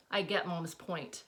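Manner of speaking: ordinary delivery, nothing marked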